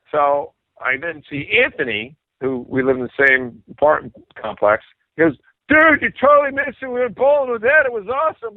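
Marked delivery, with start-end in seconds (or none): 5.7-8.5 high-pitched imitation of a thrilled friend; excited gloating. Bandmate to singer who missed bowling with Ed, excited gloating. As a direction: burst out high and loud, easing off at the end.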